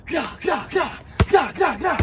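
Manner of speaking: high repeated "gah" bursts; imitated shot noises. Player pretending to shoot that thing, high shouted bursts. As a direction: rattle off high mouth-made shots. Imitated shots during play.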